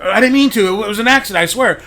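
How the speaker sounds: high, loud, desperate protest; pleading. Retold or imitated. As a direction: beg them to believe it was an accident. Pleading innocence to the teacher.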